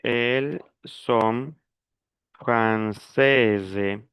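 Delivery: slow "Elles sont françaises" with a clear Z sound ending "françaises"; feminine ending sounded. Shown as a demonstration. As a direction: spell out "françaises" by ear, slowly, final consonant voiced. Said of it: "Ahí sí le agrego la S". ("Elles sont françaises" lento, marcando la terminación femenina)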